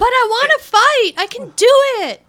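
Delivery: super high-pitched, whiny eager kid impression; Keno. Co-host mimics the movie kid forcing his lines, high-pitched. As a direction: squeak it out like an over-eager youngster. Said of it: "he's got this super high-pitched voice".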